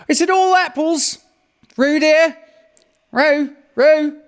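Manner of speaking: high, loud worried mother calling; anxious Kanga. A narrator's reading. Kanga calls anxiously to Roo in the stream, high and loud. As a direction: call out anxiously for her little one. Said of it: "called anx-, Kanga anxiously".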